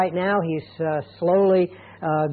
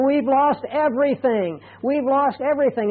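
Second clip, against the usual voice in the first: higher, anguished cry of loss; despairing quote; 6 semitones higher than usual. Retold or imitated. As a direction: voice a family that lost it all. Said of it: "they're crying, and they're saying".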